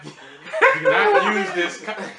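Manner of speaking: cracking up mid-warning, high and loud; laughing. Real, not acted. Host warning listeners about the cup condom, laughing. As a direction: break into loud, high laughter while pleading.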